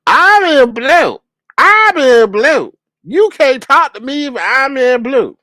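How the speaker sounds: high, slow whining imitation of a complaining man; mock whiny complaint. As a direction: whine it like a sulky child. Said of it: "He up there complaining".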